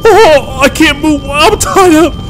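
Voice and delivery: high, loud terrified cries; panicked screaming. Acted. Tied up, surrounded by fire.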